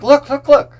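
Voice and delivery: high, loud, eager repeated look; mock-excited beckoning to a friend at the window; excited calling. Calling Robbie to the window, high and excited. Urging friend to see seagulls.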